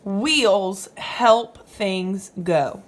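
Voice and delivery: ordinary talk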